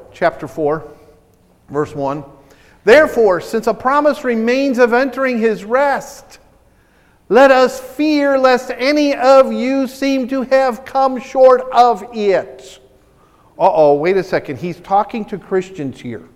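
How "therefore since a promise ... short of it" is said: slow, raised, emphatic Bible reading; scripture reading. Real, not acted. Preacher reading Hebrews to the church, emphatic scripture reading. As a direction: declaim each phrase slowly and deliberately.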